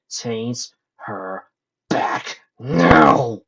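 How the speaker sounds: word by word, erupting into a loud shout; enraged demand. A narrator's reading. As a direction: build to a roared last word. Enraged demand while beating the villain.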